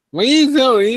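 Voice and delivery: high, loud nagging wife voice; mock nagging yell. Wife yelling at him, voiced.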